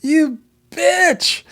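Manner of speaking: high, loud shriek at a friend; mock yelling. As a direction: imitate her screaming it in playful outrage. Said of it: "she's yelling at her and screaming".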